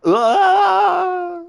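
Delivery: long high yell of a man dropped off a cliff; falling scream. Retold or imitated. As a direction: scream as you fall away. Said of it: "Arnie movie scene impression".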